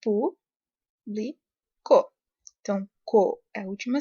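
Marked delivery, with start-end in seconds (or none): 0.0-1.4 público split into slow, separated syllables; teacher sounding out público one syllable at a time. Shown as a demonstration. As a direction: break público into pú, bli, co with clear pauses. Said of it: "nós temos".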